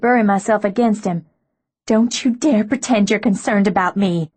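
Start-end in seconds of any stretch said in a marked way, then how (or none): none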